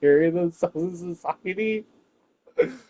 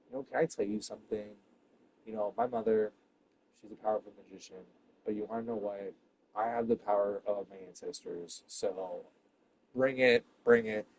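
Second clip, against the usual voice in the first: much softer, lower hushed threat; hushed menace; 6 semitones lower, 12 dB quieter than usual. Real, not acted. Comic softly threatening her magician mother. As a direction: lean in and whisper the threat.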